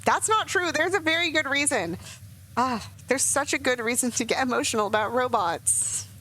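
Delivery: high, worked-up protest, easing slightly; getting emotional. Real, not acted. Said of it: "I'm getting emotional about it".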